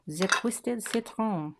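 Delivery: slow, deliberate French accent, knowingly bad; hammed-up French accent; terrible French accent. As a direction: sound out the words in a clumsy French accent. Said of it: "I have a terrible French accent".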